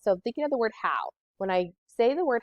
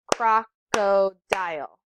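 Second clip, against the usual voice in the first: slow, drawn out into its syllables; 3 dB louder, much slower than usual. Shown as a demonstration. Teacher sounds out Crocodile slowly for the class. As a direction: say Crocodile slowly, one beat per syllable. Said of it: "three for crocodile".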